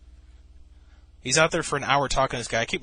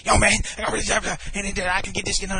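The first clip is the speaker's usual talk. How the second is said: high-pitched imitation of excited jabber; animated ranting; 8 semitones higher, 3 dB louder than usual. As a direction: mimic a worked-up guy heard through the windows. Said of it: "You could hear him from, even from inside".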